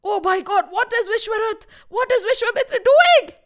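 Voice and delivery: high, rising dismay; alarmed disbelief. A narrator's reading. Gods look down and see Vishwarath, alarmed disbelief.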